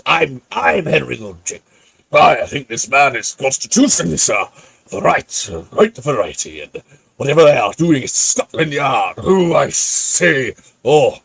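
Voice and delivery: pompous hammed-up accent from Talons of Weng-Chiang; loud theatrical mock accent; playing the puffy Doctor Who villain; put-on Wen Chiang accent. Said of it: "I love doing this accent".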